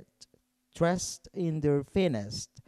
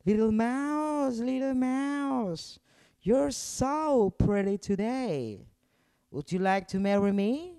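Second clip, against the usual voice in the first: cat courting the little mouse, sweet and high; 9 semitones higher than usual. Acted. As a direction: purr it out to charm her. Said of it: "said the cat in a sweet voice".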